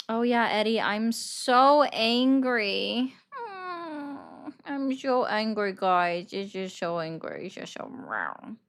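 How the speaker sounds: drawn-out, whiny fake fury, no real heat; mock anger. Streamer teasing chat with hammed-up, slow mock anger. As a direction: pretend to be furious while clearly amused. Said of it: "yet I was just smiling and laughing".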